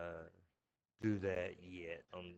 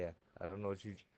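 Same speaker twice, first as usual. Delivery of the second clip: normal talking voice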